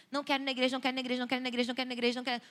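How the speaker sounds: monotone repetitive whining chant, lightly acted; insistent child's refusal. Child refusing church every Sunday, insistent repetition. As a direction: run the repeats together without a breath.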